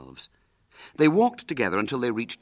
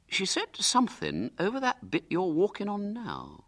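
higher-pitched, musing schoolboy; reflective. A narrator's reading. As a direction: muse aloud, as if just remembering. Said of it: "he said reflectively".

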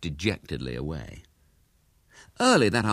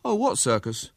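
put-on offhand, higher-pitched boy voice; careless; 5 semitones higher than usual. A narrator's reading. William pretends not to know of the circus, careless. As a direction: play casually ignorant. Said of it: "said William carelessly".

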